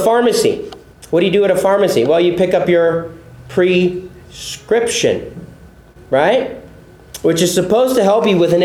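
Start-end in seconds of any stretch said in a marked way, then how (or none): 3.5-5.5 "prescription" broken slowly into PRE... SCRIPTION; split word. Shown as a demonstration. Pastor likening commands to a pharmacy, "prescription" split slowly after PRE. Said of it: "P R E".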